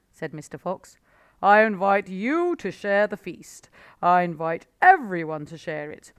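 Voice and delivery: loud, grand invitation; the fox proudly inviting everyone; generous Mister Fox. A narrator's reading.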